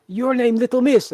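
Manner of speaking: very high, loud call; showman calling out. Acted. Tamer asking a spectator his name, loud high call. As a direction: call across to the man.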